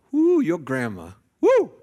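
loud, high joyful whoop; excited whoop. Real, not acted. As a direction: shout it out with joy. Preacher exclaims about praying grandma.

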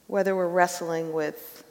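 prayer. Real, not acted. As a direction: pray quietly and earnestly. Leads the congregation in prayer.

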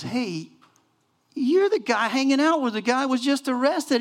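put-on high, loud accusing girl; servant girl accusing. Servant girl in the court calls Peter out, high and loud. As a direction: point him out, shrill and sure. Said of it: "saw Peter and goes".

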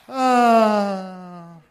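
a big, loud drawn-out sigh of relief; loud relieved ah. Acted. Preacher acting Marissa's relief as the wall falls, loud drawn-out ah. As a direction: let out a long, loud ah as the wall comes down.

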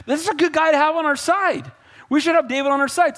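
high-pitched, urgent appeal; earnest pleading. Acted. Jonathan talking his dad out of killing David, pleading. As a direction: argue the friend's case eagerly. Son pleads with father for friend.

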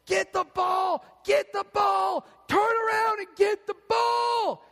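loud, high frantic scream; angry father screaming at his son in the outfield; screaming dad. Retold or imitated. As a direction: yell it like a furious parent at a ball game. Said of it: "the dad's screaming at his child".

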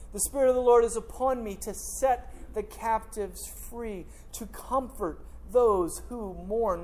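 slow, measured reading of the prophet; scripture recitation. Acted. Pastor reciting Isaiah to the congregation, slow and solemn. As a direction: lay each phrase down slowly like holy writ.

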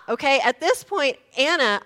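ordinary talk

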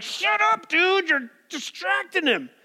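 high-pitched mock scolding, dragged out; annoyed crowd rebuke. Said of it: "And everybody's like".

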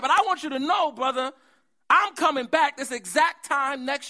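high, loud fired-up preaching; preaching at full force. Acted. Preacher giving God's promise to the disappointed, in loud high preaching. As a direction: lift the congregation with God's promise.

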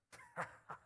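a quick high laugh at his own joke; short high laugh. Real, not acted. Preacher laughing at his pirate impression, high laugh. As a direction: burst into a short high chuckle.

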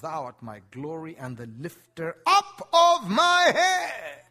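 loud, slow, ringing proclamation; solemn declaiming of scripture; psalm proclaimed. Acted. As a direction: lift the words up to the rafters. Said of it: "David said of God in the Psalms".

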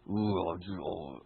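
low, soft disgruntled groan; guttural groan. Shown as a demonstration. Preacher showing the complainer's groan, low and guttural. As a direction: let out a deep grumbling moan. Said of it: "the guttural, muttering sounds people often make".

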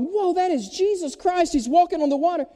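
high-pitched astonished outcry; acted panic and amazement, high-pitched; freaking out. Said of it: "they're, like, freaking out".

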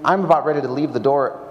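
ordinary talk

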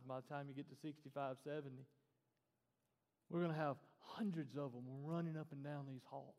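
ordinary talk, nothing marked

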